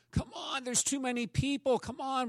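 high, urgent, half-whispered urging; impatient father. Retold or imitated. Jairus pushing through the crowd, urgent and impatient. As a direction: hurry them along through the crowd.

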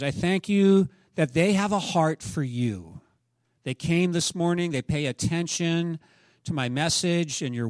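earnest prayer voice, slowing on the pleas; closing prayer. Acted. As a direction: pray over the congregation, drawn out. Pastor leads closing prayer.

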